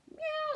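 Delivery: very high meow; high cat meow. Acted. Cat sound in the story.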